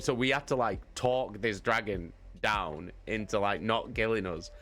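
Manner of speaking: ordinary delivery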